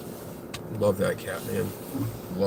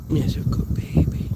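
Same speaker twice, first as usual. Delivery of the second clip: whisper to cat. Real, not acted. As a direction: whisper sweetly to her.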